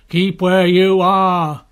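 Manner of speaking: loud, slow, drawn-out order; guard calling a warning into the mist. A narrator's reading. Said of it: "the guard called into the voice in the mist".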